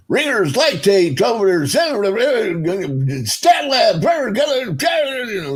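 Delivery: loud, high barked orders; imitating ER shouting. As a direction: fire off orders across the room. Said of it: "I hear him screaming".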